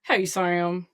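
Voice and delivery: lightly voiced weary call-in fan; new caller character. Second caller greeting radio host, subdued character voice. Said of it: "I'm gonna be a new character now".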